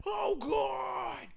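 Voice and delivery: high, drawn-out shout of horror; mock hysteria. Mimicking overreacting commentators after urging them to relax, mock hysteria. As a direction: wildly overdo the shock in a big high wail. Mimics their overblown reaction.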